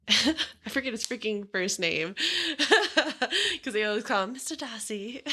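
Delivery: lightly put-on posh British lilt, laughing; put-on accent. Telling a friend about Mister Darcy in a put-on accent. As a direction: laugh through a mock English accent. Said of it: "You wanna finish the podcast in that accent?".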